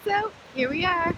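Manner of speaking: loud over roaring wind; shouting over wind. Real, not acted. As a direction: raise your voice above the surf. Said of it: "I don't know if you can hear me over the wind".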